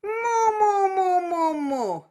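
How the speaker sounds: high, loud nasal moo falling. Shown as a demonstration. Teacher demonstrating nasal moo for students. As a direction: call moo through the nose. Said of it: "Try it".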